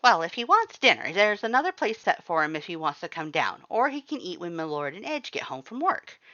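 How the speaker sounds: high, loud, bright skeleton chef; cheerful Papyrus. A narrator's reading. Papyrus cheerfully serves spaghetti, high loud cheer. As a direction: chirp it out cheerfully. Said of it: "Papyrus stated cheerfully".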